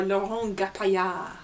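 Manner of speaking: put-on lightly French-accented name. Presenter credits the book's illustrator, in a French accent. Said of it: "I'll do the French accent as well".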